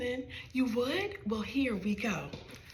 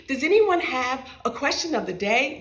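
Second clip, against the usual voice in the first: crumbly teacher voice; 3 semitones higher, 8 dB louder than usual. Acted. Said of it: "asked in a crumbly voice".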